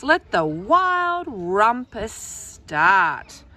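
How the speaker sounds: drawn out, very slow and commanding; slow grand proclamation. Acted. Max commanding the wild things, slow and grand. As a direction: stretch each word like a king's decree. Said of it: "cried Max".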